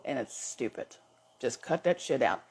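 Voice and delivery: usual speaking voice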